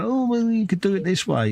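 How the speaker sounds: high mocking know-it-all voice; mock the smug viewer; imitating a know-it-all viewer. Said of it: "the keener-eyed viewer will be going".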